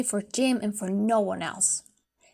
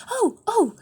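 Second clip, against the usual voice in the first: sudden high delighted cry; excited cry; 10 semitones higher, 4 dB louder than usual. Acted. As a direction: leap up crying out with joy. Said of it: "jumped out like a cat and cried".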